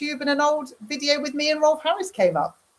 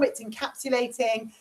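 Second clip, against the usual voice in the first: ordinary talk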